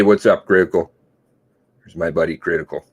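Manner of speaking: ordinary talk, nothing marked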